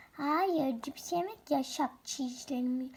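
ordinary delivery

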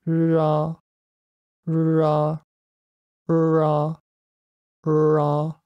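うら as held English "rrr" opening into "ah"; four English Rs. Shown as a demonstration. Teacher comparing four R methods, English R before a vowel. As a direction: glide from a long R into "ah" on うら, no tongue tap. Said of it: "こんな感じです".

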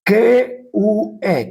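ordinary talk, nothing marked

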